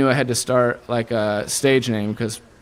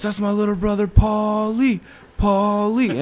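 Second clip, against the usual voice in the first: higher, drawn-out hype-man call; announcing into the mic; 8 semitones higher, slower than usual. Retold or imitated. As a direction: introduce him to the crowd like a frontman. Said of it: "my brother leans into the mic and goes".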